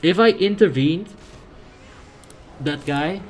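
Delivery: high, loud burst of disbelief; real frustrated cry at a botched move; exasperated outburst. Real, not acted. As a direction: blurt it out loudly, pitch jumping up in exasperation. Exasperated at own botched game move.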